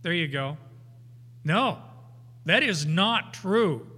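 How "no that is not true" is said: insistent correction; firm denial. Shown as a demonstration. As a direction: plant each word. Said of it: "paraphrasing Christ's firm denial".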